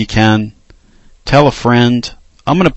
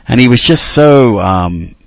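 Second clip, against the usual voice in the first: ordinary delivery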